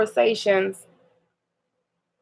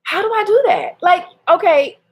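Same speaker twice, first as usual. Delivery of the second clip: exasperated; 7 semitones higher, 3 dB louder than usual. Real, not acted. Exasperated question to listeners.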